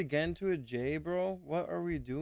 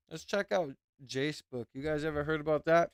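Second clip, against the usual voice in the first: talking with a mouthful of food; chewing a snack mid-sentence; chewing while talking. Real, not acted. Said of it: "Sorry, I'm chewing right now".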